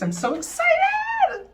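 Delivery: high, slow squeal of excitement; so excited. Real, not acted. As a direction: squeal it out with joy. Getting ready to apply makeup.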